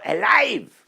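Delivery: sudden high, loud yell of one word; loud shout. Retold or imitated. Extras answering the director's cue, shouted loud. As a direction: belt it out like a crowd answering. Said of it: "I need loud voice".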